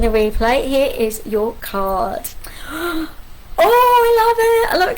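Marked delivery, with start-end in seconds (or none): 2.3-3.6 sharp gasp of delight. Real, not acted. Reader gasps on revealing the card, a delighted gasp. 3.6-5.0 high, loud burst of delight; thrilled to see her own card. Real, not acted. Reader sees her own card come up, excited and delighted.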